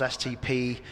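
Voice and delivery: usual speaking voice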